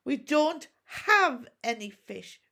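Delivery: through gritted teeth. A narrator's reading. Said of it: "from between gritted teeth".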